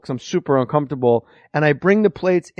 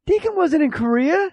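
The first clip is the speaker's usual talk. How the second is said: raised in disbelief, pitched much higher; wife correcting him, much higher pitch; incredulous wife; 11 semitones higher than usual. Retold or imitated. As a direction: say it high like it's absurd. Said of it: "she goes".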